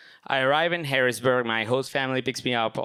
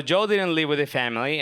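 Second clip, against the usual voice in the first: ordinary talk, nothing marked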